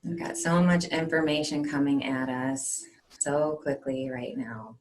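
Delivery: hushed, unhurried guide into calm; guided meditation. Acted. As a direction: settle them gently, leaving space between phrases.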